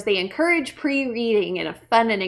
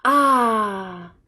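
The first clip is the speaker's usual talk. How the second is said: long, falling aah; relaxed sigh. Acted. Voicing the frog at rest, relaxed sigh. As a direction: breathe out a contented ah. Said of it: "the frog is relaxing".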